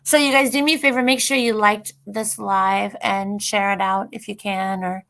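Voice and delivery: usual speaking voice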